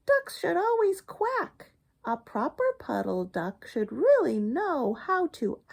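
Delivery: high, scolding hen voice; lightly squawked hen scolding; squawking hen. Acted. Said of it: "Henny squawked".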